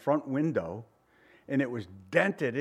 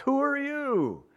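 scoffing; 8 semitones higher than usual. Retold or imitated. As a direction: sneer it down at him, pitched way up. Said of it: "the people scoffed".

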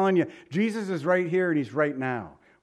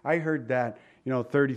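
ordinary talk, nothing marked

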